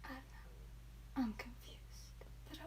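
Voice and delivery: hushed, slow, puzzled whisper. Real, not acted. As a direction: whisper it, baffled. Confused by a viewer's comment.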